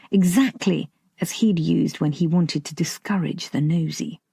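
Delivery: ordinary delivery, nothing marked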